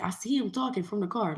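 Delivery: plain reading voice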